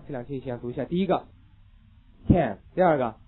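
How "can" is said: can with full vowel; can vs can't. Shown as a demonstration. Teacher modelling can for class. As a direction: say can clearly. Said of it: "大家自己现在试着把这两个读一下".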